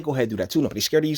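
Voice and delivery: ordinary talk, nothing marked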